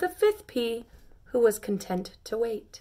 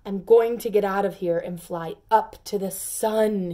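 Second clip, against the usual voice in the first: boasting; 7 dB louder than usual. Acted. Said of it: "he boasted".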